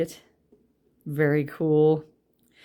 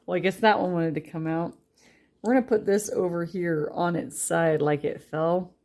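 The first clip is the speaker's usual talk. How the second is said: usual speaking voice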